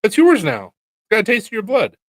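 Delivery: husband teasing wife to buy the knife, loud and high; mock urging. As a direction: egg her on to buy it. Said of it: "I was like".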